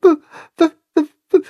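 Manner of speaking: shaky, stuttering, high and scared; halting, nervous stutter; trembling stammer. A narrator's reading. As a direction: stammer the B out like a frightened calf. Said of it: "mit zitternder Stimme".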